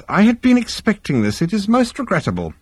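high-pitched, polite regret; regretful psychiatrist. Acted. Psychiatrist hearing his client is aboard, politely regretful. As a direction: play the smooth therapist briefly troubled.

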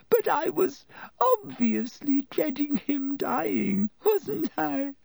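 high, weepy protest; tearful. A narrator's reading. Lavender answering Hermione through tears, weepy. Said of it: "with streaming eyes".

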